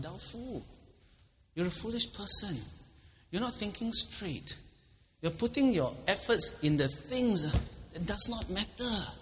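slow, high, scolding judgment; stern verdict. Acted. God scolding the foolish rich man, slow. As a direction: lay it on him slowly.

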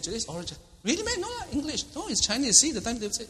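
very high, disbelieving squeal; incredulous banter. Retold or imitated. Schoolmates telling a friend the oral is Chinese, high. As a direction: tease him in disbelief. Students argue about exam language.

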